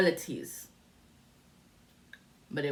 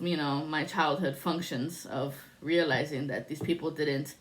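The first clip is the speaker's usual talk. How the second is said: normal talking voice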